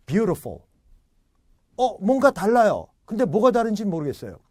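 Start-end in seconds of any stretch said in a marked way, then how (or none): 0.1-0.6 Beautiful as "BYU-ruh-fol"; usual native-like way. Shown as a demonstration. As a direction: blur the middle of Beautiful to a schwa. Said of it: "제가 평소에 하는 대로".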